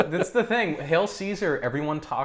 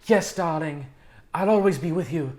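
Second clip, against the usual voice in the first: put-on old-movie Mid-Atlantic romantic line; Mid-Atlantic accent; 4 semitones higher than usual. Shown as a demonstration. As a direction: demonstrate a theatrical Mid-Atlantic accent, pitched up. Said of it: "that's the Mid-Atlantic accent".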